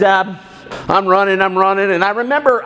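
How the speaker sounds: ordinary talk, nothing marked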